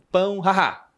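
mock high "haha" mid-speech; imitated sudden laugh. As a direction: imitate someone laughing out of nowhere. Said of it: "ela deu uma risada". (imitando uma risada repentina)

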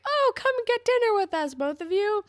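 high, loud put-on voice; over-eager mock dinner invitation; parents' eager invitation. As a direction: pipe up high like pushy parents. Voicing parents inviting the couple.

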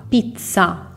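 usual speaking voice

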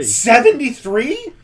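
high, slow stunned question; can't believe the number; shocked disbelief. Acted. Commander hears AI count.

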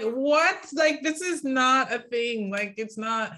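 high, loud retold disbelief; exasperated, slow-stretched objection; incredulous reaction. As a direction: draw out the protest, pitched high.

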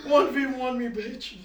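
high, loud mock fight call; playful challenge. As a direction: shout the dare at a friend. Repeating friend's challenge louder.

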